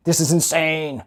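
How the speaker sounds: ordinary talk, nothing marked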